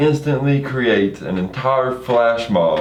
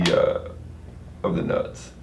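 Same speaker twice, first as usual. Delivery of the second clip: dropped to a whisper on the punchline; embarrassed whisper; 8 dB quieter, much slower than usual. Retold or imitated. Genie trails into embarrassed whisper.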